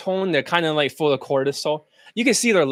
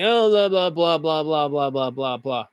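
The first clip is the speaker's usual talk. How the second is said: mock monotone babble; 3 semitones lower than usual. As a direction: run the blahs together in one drone, fading down. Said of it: "And then they continue speaking".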